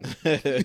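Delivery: loud hearty laugh; burst of laughter. Real, not acted. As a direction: let out a big laugh. Laughs after story.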